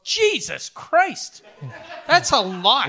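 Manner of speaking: amazed. Real, not acted. Reacting to the price.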